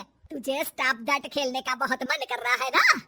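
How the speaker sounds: loud, menacing challenge; forceful mother-in-law taunting; angry goading. Acted. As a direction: build to a shouted dare. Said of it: "गुस्सा आ जाता है".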